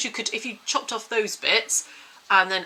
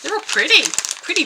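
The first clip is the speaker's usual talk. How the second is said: excited; 12 semitones higher, 10 dB louder than usual. Real, not acted. Opening the enchanted eggs.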